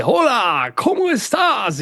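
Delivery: imitating an American's high, slow, halting Spanish; slow, careful gringo-accented Spanish greeting. Imitating his American spiritual father's Spanish with an American accent. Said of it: "él es americano, americano, dice".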